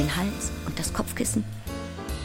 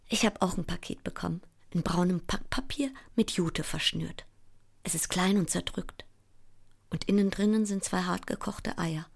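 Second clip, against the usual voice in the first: soft, low and small; subdued; 4 semitones lower, 10 dB quieter than usual. Acted. Child describing own crumpled parcel, subdued. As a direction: drop to a quiet, deflated telling.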